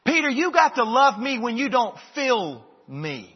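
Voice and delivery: Jesus urging Peter, said loud with weight on got; forceful plea. Acted. Preacher voicing Jesus to Peter, loud and pleading.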